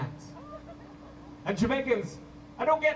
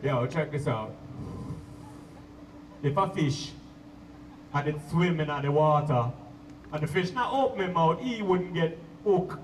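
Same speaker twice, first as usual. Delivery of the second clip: preaching roots man; 6 semitones lower than usual. Retold or imitated. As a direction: lower Jamaican accent, lay down wisdom. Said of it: "he'll be like".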